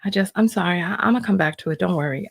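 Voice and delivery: ordinary delivery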